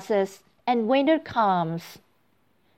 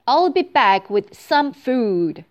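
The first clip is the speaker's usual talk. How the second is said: ugly toad voice; 3 semitones higher, 6 dB louder than usual. Acted. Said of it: "says the toad".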